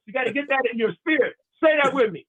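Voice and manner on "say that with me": usual speaking voice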